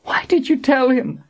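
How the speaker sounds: very high, anguished wail; weeping. A narrator's reading. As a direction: wail it like a tortured victim. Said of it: "Kara wept".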